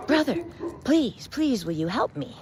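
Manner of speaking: high, wheedling plea; pleading scorpion. Acted. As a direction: beg sweetly for help. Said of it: "calls out to him".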